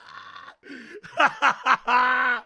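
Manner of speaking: loud burst of laughter. Real, not acted. Laughs at story.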